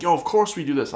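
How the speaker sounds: ordinary talk, nothing marked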